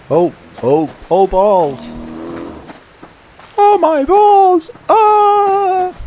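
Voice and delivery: high, loud, drawn-out panicked yell; panicked shriek. Real, not acted. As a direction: scream as the bear charges. Suddenly attacked by a bear.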